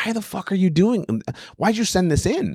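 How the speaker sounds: high, incredulous mock rant; exasperated disbelief. Exasperated at known comics' tapes.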